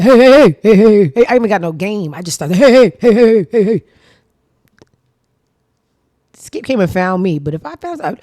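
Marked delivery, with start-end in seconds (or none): 0.0-1.3 loud, excited mock catcall; hammed-up burst of excited heys. Woman hollering at a man she fancies, excited and loud. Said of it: "I get excited". 2.5-3.8 loud, excited mock catcall; hammed-up burst of excited heys. Woman hollering at a man she fancies, excited and loud. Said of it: "I get excited".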